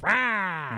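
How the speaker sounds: jump-scare roar; Green Goblin. Retold or imitated. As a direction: burst out with a mock scary "rah". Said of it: "when Green Goblin goes".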